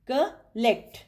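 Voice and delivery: "collect" in two beats, col, pause, lect; broken into syllables. Shown as a demonstration. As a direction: split "collect" after the first syllable. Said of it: "फिर उसके syllables निकालने हैं". ("collect" को दो हिस्सों में, बीच में रुककर)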